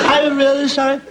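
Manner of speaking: put-on high wailing drunk apology; hammed-up weepy drunk; mock tearful drunk. Drunk wailing to the priest, mock tearful.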